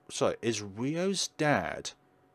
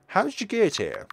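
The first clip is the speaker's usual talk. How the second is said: witch voice; 10 semitones higher, 5 dB louder than usual. Acted. Witch greets scarecrow boy.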